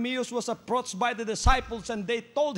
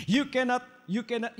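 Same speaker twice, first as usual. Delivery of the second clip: normal talking voice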